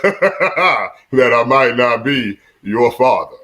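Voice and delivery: very slow, drawn-out words; mock-solemn reveal. Dad teasing his sons about paternity, slow mock-solemn reveal. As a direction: spell out the reveal slowly, mock-grave. Dad's punchline in Vader-style reveal.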